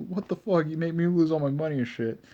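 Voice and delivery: mock Naruto complaint. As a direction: rattle off the grievance like an indignant kid. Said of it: "he's like, he's like".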